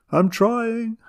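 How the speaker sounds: high, strained little-sister croak. A narrator's reading. Little sister struggling with grandmother, high croak. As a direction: squeeze it out high, like a struggling child. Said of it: "croaked little sister Nat".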